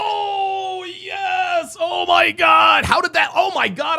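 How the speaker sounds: very high, loud shout of recognition; excited shout. Real, not acted. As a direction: burst out as the memory hits. Host suddenly remembers caller's story.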